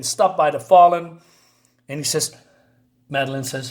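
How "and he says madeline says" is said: usual speaking voice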